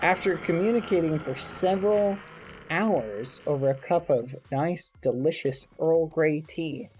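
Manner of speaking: put-on animated reading to children; lively fairy tale voice; storybook narration. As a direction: tell it like a bedtime tale. Tells Chicken Little story.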